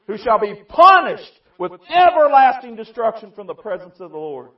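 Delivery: loud, high, slow preacher's roar; preaching at full force. Acted. Preacher roaring scripture at his church, loud and high. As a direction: thunder the verse out over the congregation.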